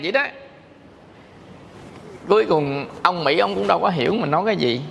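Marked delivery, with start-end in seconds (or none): none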